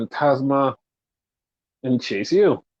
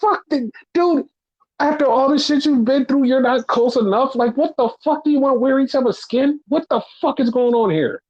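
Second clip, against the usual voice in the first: higher, a fed-up heated rant; exasperated rant; 11 semitones higher than usual. Real, not acted. Podcaster venting to cohost about tag team storyline, exasperated. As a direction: let the frustration pour out.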